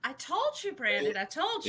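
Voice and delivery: soft high told-you-so; teasing singsong. Real, not acted. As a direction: tease him, half sung and soft. Teasing friend about theater claim.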